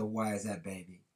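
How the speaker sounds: a lightly voiced, quiet, gentle question; father gently drawing out his daughter; calm smiling father. A narrator's reading. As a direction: ask softly with a smile. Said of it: "He calmly looked at her and smiled and said".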